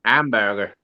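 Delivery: imitating an American hamburger; mock American hamburger. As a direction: try an American accent on hamburger. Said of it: "You all sound like Mike".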